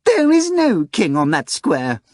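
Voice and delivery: a smug cat boasting it will win; high showy talking cat, sure of victory; pompous cat. Acted. Cat Behemoth's voice.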